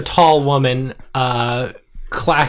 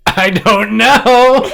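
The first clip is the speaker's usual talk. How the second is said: louder, slow laughing answer; nervous laughing; 9 semitones higher, 7 dB louder, much slower than usual. Acted. Savannah answering her worried friends, laughing nervously.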